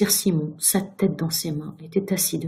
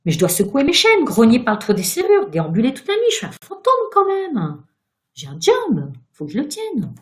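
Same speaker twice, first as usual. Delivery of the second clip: loud, high, outraged protest; indignant cry; 6 semitones higher, 6 dB louder than usual. A narrator's reading. Ghost defending his job to Virginia, indignant cry. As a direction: protest hotly, pitch leaping up. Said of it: "cria-t-il".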